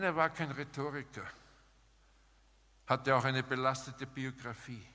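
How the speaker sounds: ordinary talk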